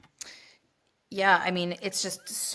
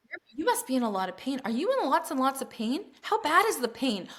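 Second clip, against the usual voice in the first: higher-pitched, overanxious questioning; mock fretting; 6 semitones higher than usual. Unhelpful helper to a woman giving birth, mock fretting. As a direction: fuss over them with mounting concern.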